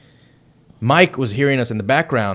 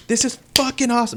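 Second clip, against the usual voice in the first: put-on inner teenager; 13 semitones higher than usual. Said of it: "the fucking fifteen-year-old in the back of my mind".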